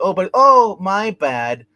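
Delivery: high, loud fake-innocent oh my bad; imitating a sheepish fake apology; mock oops. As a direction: play it like a guilty shrug. Bodhi voiced feigning an oops.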